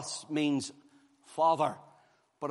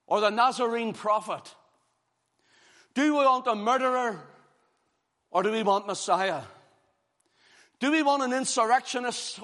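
loud, higher preaching questions; full-force preaching; 6 semitones higher, 5 dB louder than usual. Acted. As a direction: press the congregation with each question. Preaching climax, repeated questions.

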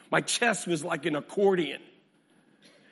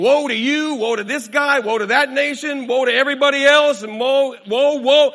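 rising, louder woes piling up; loud woe refrain; 6 semitones higher, 9 dB louder than usual. Acted. Preacher to congregation, building loud woes. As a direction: hammer each woe harder.

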